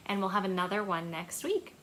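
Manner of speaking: ordinary delivery, nothing marked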